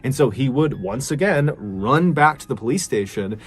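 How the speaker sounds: usual speaking voice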